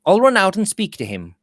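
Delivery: mildly eager, pitch lifted; keen to hurry out. A narrator's reading. Robert tells George he'll greet uncle, eager. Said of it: "cried Robert Audley".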